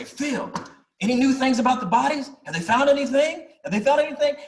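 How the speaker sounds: fast, high, repeated eager asking. Retold or imitated. Old man eagerly asking each week.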